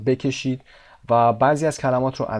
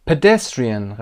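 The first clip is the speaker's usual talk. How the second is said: PEDESTRIAN with DES lifted above the rest; stress on DES; 5 dB louder, much slower than usual. Shown as a demonstration. Teacher points out the syllable, stress on DES in PEDESTRIAN. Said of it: "قسمت دس".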